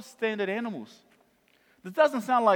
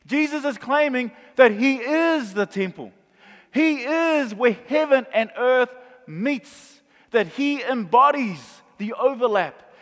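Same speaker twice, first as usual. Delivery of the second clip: emphatic proclamation; 4 semitones higher, 6 dB louder than usual. Real, not acted. Preacher building to his main point.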